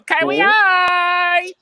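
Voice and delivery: loud, very high cutesy anime cry; E-girl kawaii squeal. Retold or imitated. Co-host demonstrating what E-girls do, mock cutesy squeal. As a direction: imitate an E-girl squealing, drawn out. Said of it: "They do one of these".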